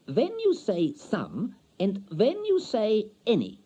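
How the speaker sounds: high, slow, careful foreign woman; lightly accented young wife; foreign wife's voice. A narrator's reading. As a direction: lift the voice high and go slow like a learner.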